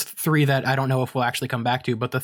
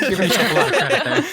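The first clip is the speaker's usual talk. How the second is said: laughing; 13 semitones higher, 4 dB louder, faster than usual. Real, not acted. Teasing a friend while laughing.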